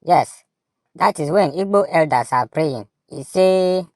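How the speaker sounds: ordinary talk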